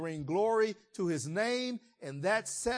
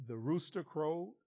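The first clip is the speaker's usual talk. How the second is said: usual speaking voice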